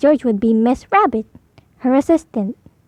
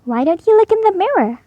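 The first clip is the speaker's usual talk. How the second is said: higher gentle mum voice; Mummy Pig; 7 semitones higher than usual. Acted. Mummy Pig gently suggests Peppa check the mirror. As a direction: suggest it kindly. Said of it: "said Mummy Pig".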